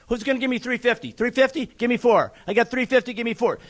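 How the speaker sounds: rapid, rhythmic auctioneer patter pushing the bids; auctioneer bid calling. Acted. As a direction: keep the numbers rolling like a real auctioneer. Second lot bidding.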